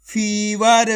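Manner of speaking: फीवर with a long ee; drawn-out reading. Shown as a demonstration. Teacher joins the letters into a word, drawn-out फीवर. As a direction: stretch the फी in फीवर. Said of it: "आपको खींच के पढ़ना है".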